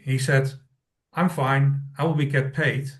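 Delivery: usual speaking voice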